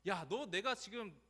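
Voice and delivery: acted rebuke, demanding an explanation; scolding. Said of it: "이렇게 질책하거나 막 정죄하는 느낌". (질책하듯)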